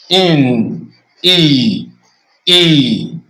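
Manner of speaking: E said twice as a long clear "ee"; vowel demo. Shown as a demonstration. Teacher repeating E as a clear "ee". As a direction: model E as in machine. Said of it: "as you pronounce it in machine".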